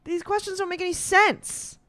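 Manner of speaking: exasperated high complaint. Real, not acted. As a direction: complain in rising disbelief. Baffled by odd quiz question.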